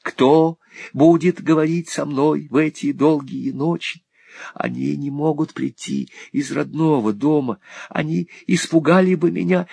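put-on a lonely boy afraid of death; Smike's terror. A narrator's reading. (Смайк в ужасе, высоко и медленно)

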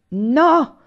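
loud, high, drawn-out cry; shouted refusal. A narrator's reading. Anania refuses Olì's plea, shouted refusal. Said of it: "egli ripeté".

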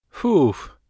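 high, loud "phew" of relief; relieved girlish phew; relieved exhale. A narrator's reading. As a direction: let out a relieved puff. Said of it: "успокоенно выдохнула Кира".

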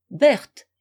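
calling a name loudly through a locked door; husband calls his wife's name loudly through the door; shout. A narrator's reading. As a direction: call out her name, loud. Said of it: "crie la voix d'Eugène".

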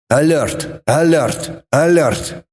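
synthetic alert readout, falling each time; evenly repeated machine warning; electronic alert. A narrator's reading. Warning signs flash on the ceiling, electronic alert.

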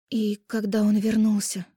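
slow, high, cracking question; strained, breaking voice; broken rasp. A narrator's reading. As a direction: push the words out through grief. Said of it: "Надломленно, хрипло вытолкнула Даша".

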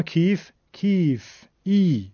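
slow کیف, the "ee" drawn out, then ای alone; long yaa. Shown as a demonstration. Teacher isolating the vowel, long drawn "ee" in کیف. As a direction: hold the "ee" of کیف and ای.

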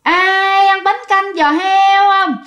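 high, drawn-out sing-song hawker's call; shrill female street-vendor cry. A narrator's reading. Ghostly vendor calling out pork-knuckle noodle soup at night, high sing-song cry. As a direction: chant it slowly like a woman selling from a shoulder pole. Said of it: "giọng lanh lảnh của một người phụ nữ".

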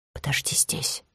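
very soft whispered instruction; hushed, breath-only voice. A narrator's reading. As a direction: whisper it so only your companion hears. Hiding, tells companion to wait.

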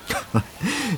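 short, light laugh of satisfaction; soft satisfied laugh; pleased chuckle. Acted. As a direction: chuckle at a good deal. Pleased with the trade.

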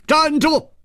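a high, ringing command to halt; a commanding high yell to freeze; sharp shout. Acted. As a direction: bark stop at him like a threat. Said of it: "突听李玉涵一声大喝".

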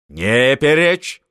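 slow loud stern command; husband cutting off his wife; stern order. A narrator's reading. Marshal silences his wife. (медленно, властно, громко)

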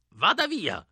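put-on high-pitched, strained old-woman cry; old woman shouting. A narrator's reading. Old woman to boys behind her door, crying out. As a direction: screech it as a scared old woman, without much volume. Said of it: "gridò lei".

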